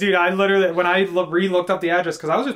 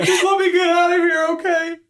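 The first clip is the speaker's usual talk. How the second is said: much higher mock-pleading voice; imitating the nervous worker; 10 semitones higher, 4 dB louder than usual. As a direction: beg to be left alone, pitched up. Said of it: "He's like".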